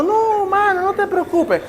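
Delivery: high, hammed-up weepy voice; mock crying through the words; tearful protest. Sofía answering through mock tears, high and weepy. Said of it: "la hermana Sofía llorando".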